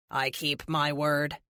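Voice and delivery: low, measured robotic villain; smooth and slightly slow menace; cold android leader. A narrator's reading.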